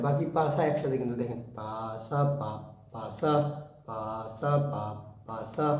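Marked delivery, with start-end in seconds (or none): 1.6-3.3 "পা সা পা", slight gap, then "পা সা" joined; gap in the beat. Shown as a demonstration. Teacher shows students the gap, "পা সা পা" then a low, joined "পা সা". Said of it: "দিয়ে অল্প একটু গ্যাপ হয়". 3.9-5.7 "পা সা পা", slight gap, then "পা সা" joined; gap in the beat. Shown as a demonstration. Teacher shows students the gap, "পা সা পা" then a low, joined "পা সা". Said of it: "অল্প একটু গ্যাপ হয়".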